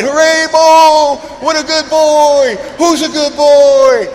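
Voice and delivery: very high, loud, slow doggy praise; praising the puppy. Retold or imitated. Owner cheering the puppy after he goes, high and loud. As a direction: gush like an owner praising a dog. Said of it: "you better throw a party".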